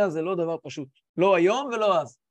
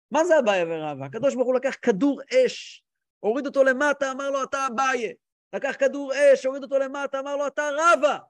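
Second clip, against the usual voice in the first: raised, louder, emphatic telling; fervent proclamation; 5 semitones higher, 4 dB louder than usual. Acted. Reb Zusha tells of God hurling fireballs as sages, fervent proclamation. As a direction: declare it with mounting fervour.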